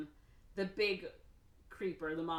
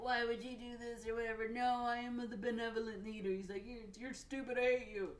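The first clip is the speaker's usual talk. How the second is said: put-on Marshall voice; 5 semitones higher than usual. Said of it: "Marshall's like".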